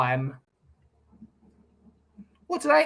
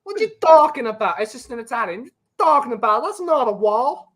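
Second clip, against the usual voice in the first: hammed-up New York accent sliding into Italian-American; bad New York accent; 4 semitones higher, faster than usual. Shown as a demonstration. Host has another go at his botched New York Italian accent. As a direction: do a badly faked New York Italian, raised pitch. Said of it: "I can't do a New York accent".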